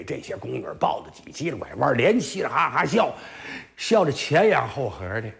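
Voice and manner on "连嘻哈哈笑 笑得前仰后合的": usual speaking voice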